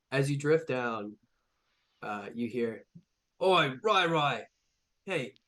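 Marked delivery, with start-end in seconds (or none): 3.4-5.3 wrong voice. Acted. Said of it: "sorry, that's not the voice".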